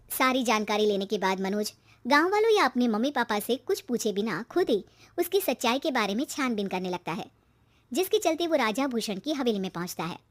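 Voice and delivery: ordinary delivery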